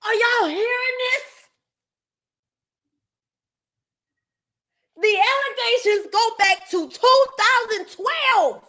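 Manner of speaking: high-pitched, outraged disbelief; yelling. Real, not acted. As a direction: call it out high, appalled. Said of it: "I'm sorry if I'm yelling".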